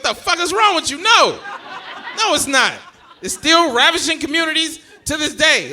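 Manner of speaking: high, loud, exasperated telling-off; mock scolding. Google answering a stupid search, mock outrage. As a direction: berate him in comic outrage.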